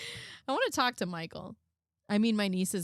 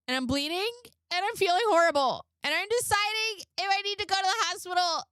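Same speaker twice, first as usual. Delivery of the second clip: higher, whiny mock read of a dramatic text; mock dramatic girlfriend; 8 semitones higher than usual. Reading Laura's texts to Michael in a higher, whiny mock voice. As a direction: ham up the self-pity as you read it.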